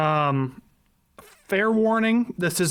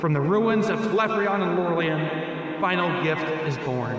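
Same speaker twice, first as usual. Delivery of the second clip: dramatic narrator voice. Acted. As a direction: read it like a fantasy trailer. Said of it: "With your narrator voice".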